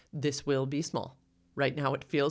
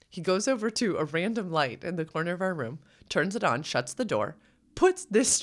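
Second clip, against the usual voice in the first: ordinary delivery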